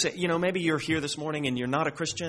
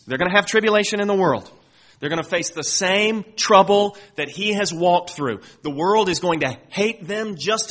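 higher, louder, fast warning; fervent preaching; 5 semitones higher, 6 dB louder than usual. Real, not acted. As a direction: press it urgently on the crowd. Preacher building intensity.

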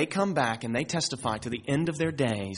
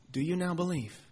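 gentle question; 3 semitones higher, 7 dB quieter than usual. Acted. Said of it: "Jesus answered them".

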